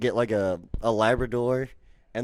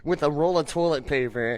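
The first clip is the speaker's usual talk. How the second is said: normal talking voice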